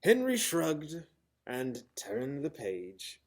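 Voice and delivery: plain reading voice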